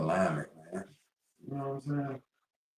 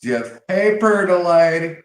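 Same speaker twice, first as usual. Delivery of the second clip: much higher, louder sing-song greeting; loud call; 9 semitones higher, 10 dB louder than usual. Real, not acted. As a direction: hail her across the room. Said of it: "calling out to Jeff".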